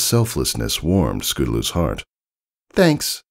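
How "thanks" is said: put-on young filly. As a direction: thank her hero sincerely. Scootaloo voiced.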